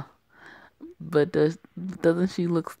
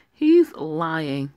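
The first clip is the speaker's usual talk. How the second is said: "He's lying" pushed out louder; indignant louder accusation; louder protest; 7 dB louder than usual. Acted. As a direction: insist over him. Arguing against the faking patient.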